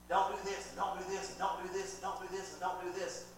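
mock parental rule list, repeated evenly; nagging parent. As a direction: rattle off prohibitions in a repetitive chant. Voices parents nagging their children.

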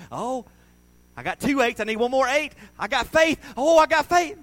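high, loud, building to near shouting; hammed-up rising thrill; acted gambler's excitement. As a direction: get worked up as the ticket almost wins. Acting a gambler scratching a ticket.

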